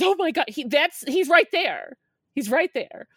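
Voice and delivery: a husband who can't believe his eyes; excited husband. Retold or imitated. Husband spotting the bib thief.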